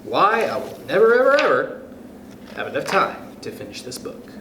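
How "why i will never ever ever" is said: plain reading voice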